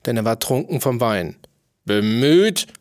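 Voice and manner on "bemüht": loud, angry echoed question; furious shout. A narrator's reading. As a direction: fling the word back at him in rage. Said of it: "schrie der Tyrann wütend".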